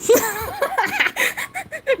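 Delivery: high, soft run of laughter; high giggling laugh. Real, not acted. Streamer amused by Thunder's jealousy, high helpless laugh.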